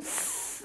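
S held alone as a hiss, no voicing; isolated C sound. Shown as a demonstration. Teacher showing pupils the C sound, S held on its own. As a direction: sound out a long, voiceless S. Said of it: "this is the C sound".

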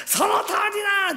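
high crying yell; son's tearful shout. Retold or imitated. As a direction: shout it near tears. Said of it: "って言ったら息子が".

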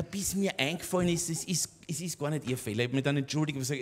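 normal talking voice

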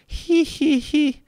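high wheezy cruel giggle; squeaky cruel laugh. A narrator's reading. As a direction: cackle cruelly at the children. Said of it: "The laugh was squeaky, and it was wheezy".

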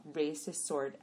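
plain reading voice

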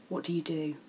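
ordinary delivery

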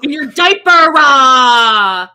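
hammed-up parental yelling; loud impression yell. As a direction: holler it with the last word stretched out. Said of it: "Any other impressions you guys want?".

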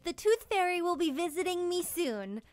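ordinary delivery

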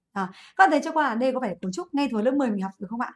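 ordinary talk

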